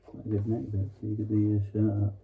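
muffled behind latex mask; through a face mask. Real, not acted. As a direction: voice dampened by mask. Seen 0: Full latex mask covers face throughout; R frames show bare face.

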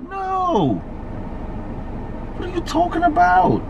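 normal talking voice

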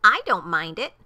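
loud, high, defiant eagle; punchy, exclaimed bird's brag; taunting call back. A narrator's reading. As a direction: holler a boastful taunt over your shoulder. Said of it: "over his shoulder called back".

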